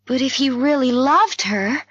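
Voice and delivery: high-pitched, puzzled objection; object, pitch rising to the end; protesting question. A narrator's reading. Narrator doubting the doctor's account of Fred, in protest.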